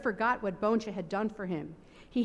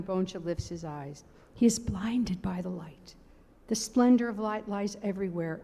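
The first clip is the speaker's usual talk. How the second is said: ordinary delivery, nothing marked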